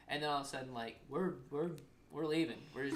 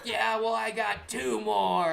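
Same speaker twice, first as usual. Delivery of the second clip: higher, louder drawn-out mimicked retort; yelled comeback; 7 semitones higher, 8 dB louder, much slower than usual. Mom answering son's guilt-trip, loud and defiant. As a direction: belt out an imitation of a mom's savage reply. Repeats mom's savage comeback.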